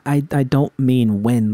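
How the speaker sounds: usual speaking voice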